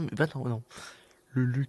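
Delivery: ordinary talk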